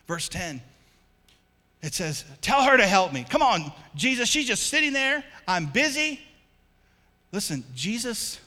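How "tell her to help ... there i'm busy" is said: loud, high, exasperated demand; indignant shout of a busy sister; Martha's complaint. Acted. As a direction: complain loudly to Jesus like a harried host.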